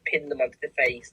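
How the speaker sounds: ordinary talk, nothing marked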